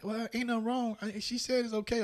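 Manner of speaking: high mock voice of friend arguing; imitating friend.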